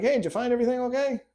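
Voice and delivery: mock cheerful cashier greeting; chirpy cashier. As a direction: rattle it off like a checkout lady on autopilot. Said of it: "They're like".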